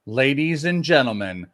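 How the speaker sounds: announcer voice. Acted. Announcer-style wheel reveal.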